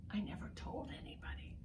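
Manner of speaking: a quick whisper, as if sharing a secret. Acted. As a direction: hush it like a confession to the kids. Reading a secret confession aloud.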